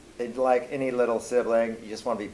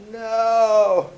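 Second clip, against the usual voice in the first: mock protest yell; 11 semitones higher, 8 dB louder than usual. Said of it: "And I'd be like".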